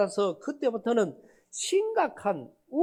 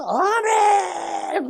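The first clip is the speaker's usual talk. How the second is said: drawn-out, higher, loud amen; shouted amen; 8 semitones higher, 6 dB louder, much slower than usual. Retold or imitated. Man shouting amen from the back of the church, drawn out. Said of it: "그러는 거예요".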